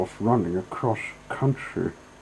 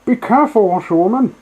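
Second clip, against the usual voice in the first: much higher, loud warning shout; warning call; 13 semitones higher, 11 dB louder, faster than usual. Acted. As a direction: yell a warning at the reckless driver. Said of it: "call out warningly".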